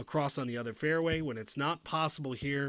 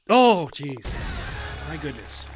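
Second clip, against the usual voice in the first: loud groan of dismay; 6 dB louder than usual. Real, not acted. Bad shot reaction.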